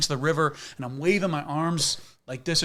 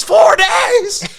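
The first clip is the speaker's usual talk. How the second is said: high, loud, incredulous cry; horrified disbelief shouted across water; appalled ranger; 13 dB louder than usual. Retold or imitated. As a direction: ranger appalled at four days out. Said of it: "he's just appalled at everything".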